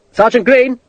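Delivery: loud, high call; officer calling for his sergeant. A narrator's reading. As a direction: call the name across the field. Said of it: "Sharp called".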